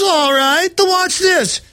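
lightly voiced smug gangster; mock goon. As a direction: play the gloating heavy. Mocking a thug calling cops.

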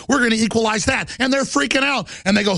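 normal talking voice

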